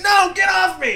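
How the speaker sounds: high, loud imitated yell; frustrated kid shout. Kid fighting off swarm in game, mock frustrated shout. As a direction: mimic a kid losing it mid-game. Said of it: "he starts getting frustrated".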